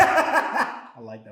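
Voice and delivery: slow, laughing through it. Real, not acted. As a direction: laugh while saying it. Laughing at joke.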